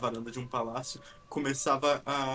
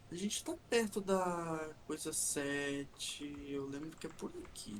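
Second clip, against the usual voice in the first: whispering while hiding; 9 dB quieter, slower than usual. Real, not acted. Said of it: "Não grita aqui".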